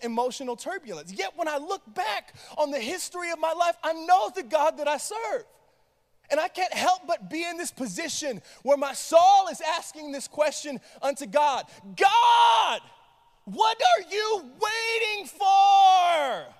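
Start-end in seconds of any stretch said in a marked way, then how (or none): 1.1-11.6 impassioned, raised preaching. Acted. Preaching builds. 12.0-16.5 high, loud, slow plea to God; desperate cry. Real, not acted. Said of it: "This is the sentiment that I feel".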